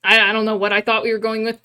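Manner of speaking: ordinary talk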